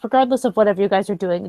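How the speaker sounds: usual speaking voice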